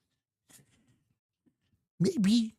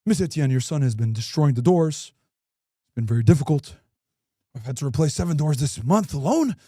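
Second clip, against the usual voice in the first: deep, stiff voice of a school principal; 12 semitones lower than usual. Retold or imitated. Principal telling the mom her son broke the doors, deep and formal. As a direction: lower and formal, bad news delivered politely.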